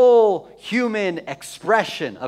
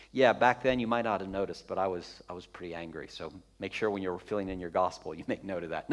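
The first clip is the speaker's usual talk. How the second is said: low, much softer, offhand afterthought; understated aside; 9 semitones lower, 7 dB quieter than usual. Acted. Jesus filling John in later on his anger, low understated aside. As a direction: drop it right down, as if quietly briefing someone later.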